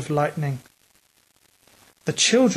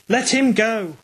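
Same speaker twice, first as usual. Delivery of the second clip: higher, urgent shouted command; 6 semitones higher than usual. A narrator's reading. Kirk shouts at the man holding Ralph. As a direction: yell at the man to let go. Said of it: "shouted Kirk".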